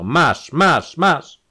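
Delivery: high, loud, escalating bursts; furious blows. A narrator's reading. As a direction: hurl each „masz“ harder, as with blows. Enraged count attacks the countess.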